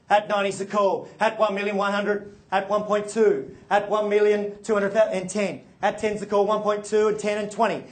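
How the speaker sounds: rhythmic auction bid calling; auctioneer call. Real, not acted.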